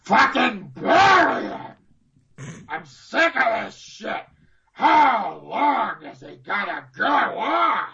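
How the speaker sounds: slow, strained bellow as an irate boss; exaggerated fed-up boss impression, stretched and swooping; Vince impression. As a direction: drag each word out in a disgusted roar. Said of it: "quite a good Vince impression".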